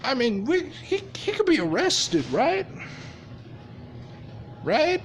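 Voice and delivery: high, falsetto fake-innocent voice, pleading rising Right?; mock innocent. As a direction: play dumb in a squeaky sweet voice to needle Robin. Izuku feigning innocence to Robin.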